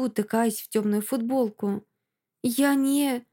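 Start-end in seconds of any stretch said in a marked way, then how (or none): none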